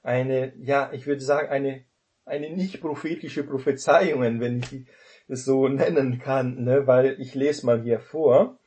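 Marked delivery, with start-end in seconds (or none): none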